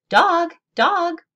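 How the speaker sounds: high loud squawking Dog; screaming parrot. A narrator's reading. Parrot screaming at the chasing dog, loud high squawk. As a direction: shriek it like a frantic bird. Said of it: "Gertrude kept screaming".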